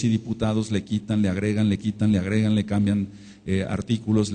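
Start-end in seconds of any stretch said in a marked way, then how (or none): none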